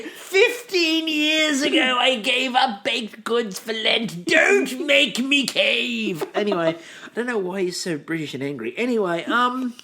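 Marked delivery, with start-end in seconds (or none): 0.0-6.2 slow, high, drawn-out mock lament; hammed-up pious confession. Host joking about Lent, slow hammed-up lament. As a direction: ham up the anguish of giving up cake.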